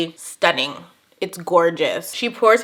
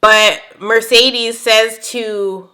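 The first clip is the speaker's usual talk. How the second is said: ordinary talk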